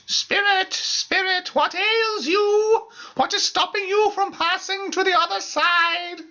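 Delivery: very high, wailing summons; medium calling the spirit. Acted. Medium imploring the spirit, high wailing summons. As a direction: cry out to the spirit, drawn-out and dramatic.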